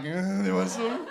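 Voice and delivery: slow, lazy offer in a put-on voice; shady street seller. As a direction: hawk it like a sketchy guy on the corner.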